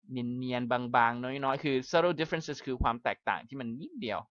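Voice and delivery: usual speaking voice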